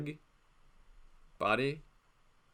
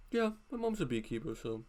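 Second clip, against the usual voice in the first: put-on lying kid voice; 3 semitones higher, 7 dB quieter than usual. Imitating a lying school kid again.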